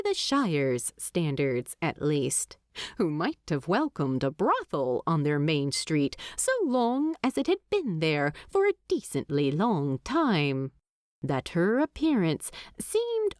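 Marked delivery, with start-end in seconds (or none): none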